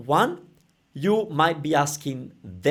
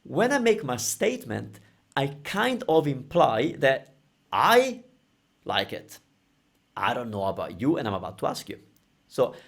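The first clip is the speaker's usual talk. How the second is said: ordinary delivery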